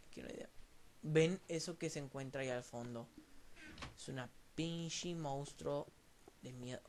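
ordinary talk, nothing marked

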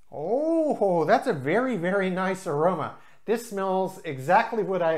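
savouring a lovely smell out loud; delighted reaction. Real, not acted. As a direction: swoop up on a pleased "oh". Pleased reaction on sniffing the beer.

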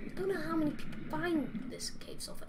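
whispered grumbling to himself. Real, not acted. Player grumbling while searching for sulfur, whispered. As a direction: whisper it, fed up and quiet.

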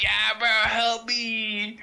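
put-on stretched, pleading drunk voice; slow drunk plea. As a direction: drag it out like a helpless drunk. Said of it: "I'm like".

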